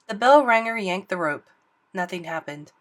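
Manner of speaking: usual speaking voice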